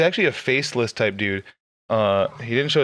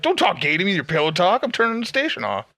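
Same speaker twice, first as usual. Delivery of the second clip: mock grumpy grandpa; 7 semitones higher than usual. Mocking grandpa scolding the radio.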